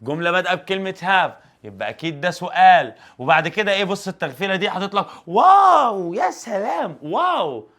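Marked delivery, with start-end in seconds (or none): 5.3-7.7 mock amazement. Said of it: "ده إيه الاندهاش الجامد".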